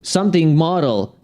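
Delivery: usual speaking voice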